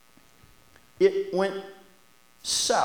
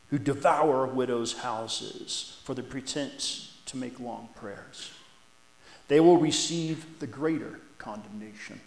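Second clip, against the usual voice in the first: measured, solemn reading of the Gospel, louder at the start; scripture reading; 6 dB louder than usual. Acted. Preacher reading Mark's Gospel to the church, solemn and measured. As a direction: read the passage to the congregation with weight.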